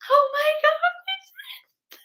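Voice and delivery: very high, loud fangirl shriek; crowd scream. Retold or imitated. Theater crowd screaming at a surprise return, very high and loud. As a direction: shriek it like the whole audience.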